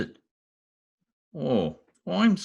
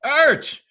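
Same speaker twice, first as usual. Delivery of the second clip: sudden high loud yelp of pain; 10 semitones higher, 5 dB louder than usual. Acted. McGee's foot gets crushed by the piano, pained yelp. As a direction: cry out as the piano lands on your foot.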